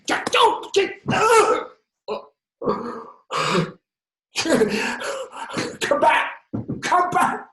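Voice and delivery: high, full-force screams in broken bursts; frantic, high-pitched yelling with gaps; desperate shouting. Acted. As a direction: scream after someone running away. Captive escapes, calling after him.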